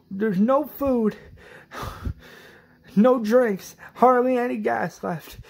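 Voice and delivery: high, loud begging; desperate pleading. Retold or imitated. Rick begging at the gate for women and children, desperate and loud. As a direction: act out a desperate plea to be let in. Acted plea at the locked gate.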